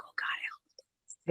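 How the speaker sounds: unvoiced whispered aside; whispering. Real, not acted. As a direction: whisper it under breath.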